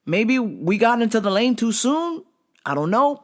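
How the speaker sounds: high, earnest pleading to the guy. Retold or imitated. Driving by, pleading with the angry guy, high earnest voice. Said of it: "and I was like".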